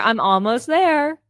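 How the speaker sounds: loud, high, drawn-out pretend update; fake phone call. Faking a call while being followed, loud pretend update. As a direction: announce it slowly for eavesdroppers. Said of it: "I pretend I'm on the phone".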